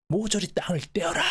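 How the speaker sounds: high-pitched stern order; stern command. Acted. Elder laying down an order to the Park clan, stern command.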